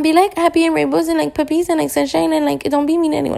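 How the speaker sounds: high, sing-song mock cheerfulness; mock sugary positivity. Mocking unrealistic advice to be nice, in a high sugary voice. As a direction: parody toxic positivity, all sweetness up high. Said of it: "not gonna be unrealistic and be like".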